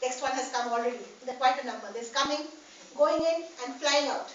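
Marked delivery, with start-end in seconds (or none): none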